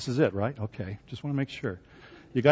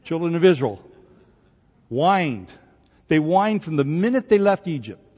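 ordinary talk, nothing marked

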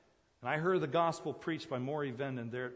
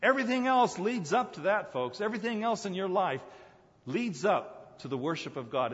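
louder, higher pulpit climax; preacher driving home worship, louder; climactic preaching; 7 semitones higher, 10 dB louder than usual. Real, not acted. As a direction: build to the big point.

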